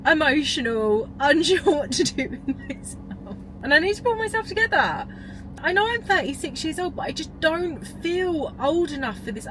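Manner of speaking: real emotional wobble, a nervous laugh breaking in; tearful laugh-crying. Real, not acted. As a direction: voice jumping high with real tears, half laughing at herself. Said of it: "pretty much crying, emotional".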